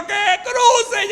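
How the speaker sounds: wife yelling at the husband to cross; high exasperated shout. Retold or imitated. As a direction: scream the order. Wife's final yell.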